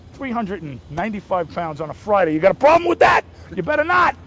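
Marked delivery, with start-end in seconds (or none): 2.3-4.1 loud, high, joking threat at the interviewer; mock tough guy. Actor playfully threatening the interviewer in a loud tough-guy voice. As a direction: lean in like a goon daring him to argue.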